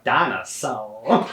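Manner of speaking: slow drawn-out southern "Dinosaur"; southern accent. Retold or imitated. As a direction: drawl it like a Southern cartoon narrator. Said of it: "southern accent".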